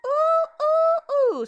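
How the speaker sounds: high delighted ooh; high excited squeal over Greek dinner. Real, not acted.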